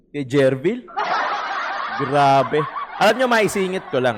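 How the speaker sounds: amused laugh breaking into the line. Real, not acted. Commentator laughing while praising the attorney.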